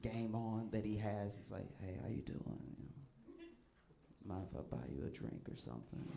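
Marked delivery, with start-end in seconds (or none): 1.8-6.0 smooth pickup line. Retold or imitated. Comic retells the friend's pickup line.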